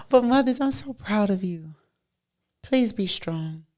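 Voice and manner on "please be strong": ordinary delivery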